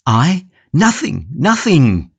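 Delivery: high, panicked denial; a prisoner squeaking denials in alarm; frightened cry. Acted. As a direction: cry out, scared to be caught. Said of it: "cried Cornelius, trembling".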